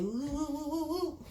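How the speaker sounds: high sing-song woo woo; imitating notification sound. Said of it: "It just said".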